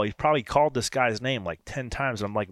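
usual speaking voice

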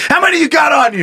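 high-pitched mock interrogation; hemorrhoid hunter. Hunter frisking a man against a wall, mock demanding. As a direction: grill the suspect like an over-keen cop.

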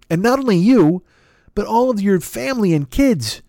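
ordinary talk

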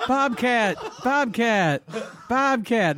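high, falling name-call; imitated heckling call. Daughter pestering her dad during stand-up, high mock call. Retold daughter calling out from audience.

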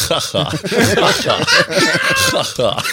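mock forced "haha", the last shouted; fake laugh. Player mocking a humourless wizard, fake forced laugh. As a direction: laugh without meaning it. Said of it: "er lacht auch nicht wirklich".

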